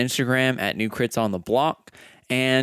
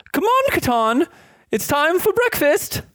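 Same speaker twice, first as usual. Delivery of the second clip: much higher, calling from the next room; mother calling. Acted. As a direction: call your son to breakfast. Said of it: "your mother's voice".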